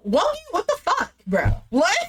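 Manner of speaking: baffled, squeaky protest; incredulous outburst. Real, not acted. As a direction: voice shoots up in exasperated disbelief. Exasperated reaction to Wongi.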